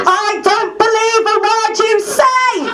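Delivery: high, slow shouted "I don't believe a word you say!"; scornful shouted interruption; loud heckle. Retold or imitated. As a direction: throw it back at her. Constituent heckling MP at meeting.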